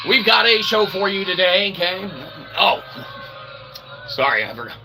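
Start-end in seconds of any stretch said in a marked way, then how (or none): none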